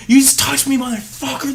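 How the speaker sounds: high, shrieked accusation; mock furious yell. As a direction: shriek it like an enraged customer. Wife screaming accusation.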